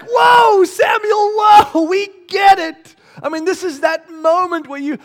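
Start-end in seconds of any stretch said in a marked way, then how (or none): 0.0-3.0 high, loud, slow whoa; loud mock-overwhelmed plea; overwhelmed listener shout. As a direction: shout them to stop, overwhelmed. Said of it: "You're just like".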